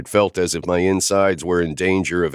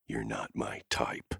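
nearly silent, much softer and lower; mouthed; 7 semitones lower, 15 dB quieter than usual. A narrator's reading. Lucian mouthing back at Sloane, near silent. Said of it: "I mouthed back".

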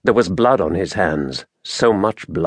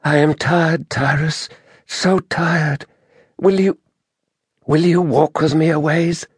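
high, tired woman's voice, halting; Merinda; 8 semitones higher than usual. A narrator's reading. Exhausted druid asks Tyrus to walk along, weary plea. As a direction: falter wearily, then plead in a high voice.

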